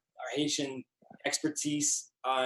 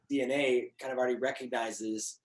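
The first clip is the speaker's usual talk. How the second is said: normal talking voice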